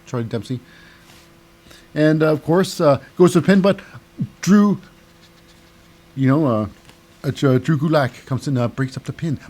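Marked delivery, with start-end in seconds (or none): none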